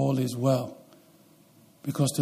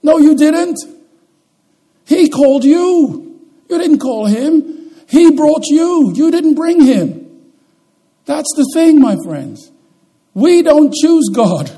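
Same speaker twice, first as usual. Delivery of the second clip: high, loud, slow pulpit declaration; preaching at full force; 11 semitones higher, 8 dB louder than usual. Acted. Preacher to his congregation, proclaiming loud and high. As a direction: hammer each line home to the congregation.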